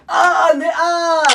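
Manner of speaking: slow drawn-out high cry of pain. Acted. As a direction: cry out as if struck. Prisoner hit during photo.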